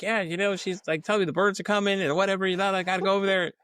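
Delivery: mock dazed girl. Girl explaining birds.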